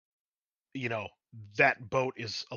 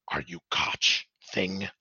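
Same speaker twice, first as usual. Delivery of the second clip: raspy Skaven voice; 2 semitones lower than usual. Acted. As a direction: question him in a raspy creature whisper. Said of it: "raspy voice".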